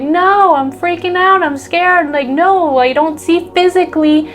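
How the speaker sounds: anxious ego inner voice, mock panic; panicky ego voice. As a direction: play the frightened inner voice freaking out. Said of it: "our ego wants to take over".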